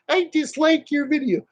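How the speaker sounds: high squeaky mocking baby voice; little baby. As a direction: whine it like a bratty little baby. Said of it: "like being a little baby".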